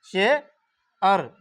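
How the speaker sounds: शेर split slowly into 'she' and 'ar'; share in two sounds. Shown as a demonstration. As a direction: say शेर as she, pause, ar. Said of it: "समझाने के लिए यहाँ अ लिख दिया हूँ".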